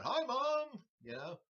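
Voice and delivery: high, giddy shout to mom on camera; trophy winner calling out to his mom, giddy and high; excited winner. Retold or imitated. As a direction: squeal it like a champ waving at the camera. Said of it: "holding the trophy up going".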